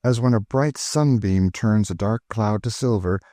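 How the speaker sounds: ordinary talk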